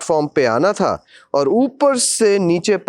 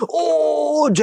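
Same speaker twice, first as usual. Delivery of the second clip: imitated scream; 13 semitones higher than usual. Screaming people above.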